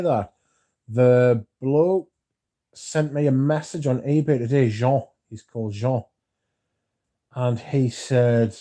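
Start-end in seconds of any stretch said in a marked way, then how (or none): none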